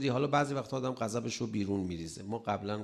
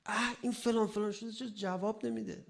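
high-pitched mock frustrated cursing; a hint of a man exasperated at an unanswered call; mock irritation; 11 semitones higher than usual. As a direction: act out someone fed up and muttering curses. Acting an irritated person cursing.

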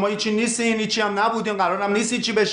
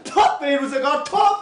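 higher, rising indignant rant, peaking on the last word; 7 semitones higher than usual. Acted. Ex-star ranting at his friend about lost fame, furious. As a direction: build it up into a furious outburst.

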